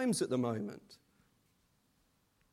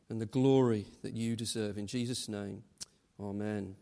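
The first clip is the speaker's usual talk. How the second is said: prayer; 7 semitones lower than usual. Acted. Leads a congregational prayer.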